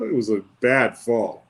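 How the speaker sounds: ordinary talk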